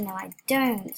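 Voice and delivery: normal talking voice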